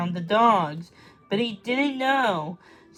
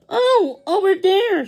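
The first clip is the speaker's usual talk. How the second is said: high loud excited shout; 11 semitones higher, 8 dB louder, slower than usual. Acted. Lizzie spots the puppy and shouts to Bean. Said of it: "she shouted".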